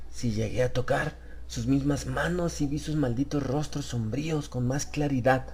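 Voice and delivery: pouring out what he saw; distraught Harris. Acted. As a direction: Harris insisting to the stranger, shaken.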